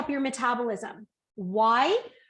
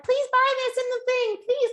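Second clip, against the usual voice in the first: put-on much higher, louder pleading child voice; imitating a whiny kid; 11 semitones higher, 4 dB louder than usual. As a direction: beg like a kid at the checkout. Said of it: "they're like".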